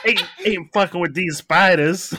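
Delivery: high, loud Australian attempt sliding into American regional; botched Australian accent. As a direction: laugh through a badly faked Aussie accent. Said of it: "You sound like you're from where I live".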